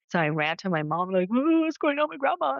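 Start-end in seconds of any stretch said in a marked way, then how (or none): 1.3-2.6 put-on high, frightened child's question; scared little kid. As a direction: blurt it out like a spooked kid. Scared child runs to mom.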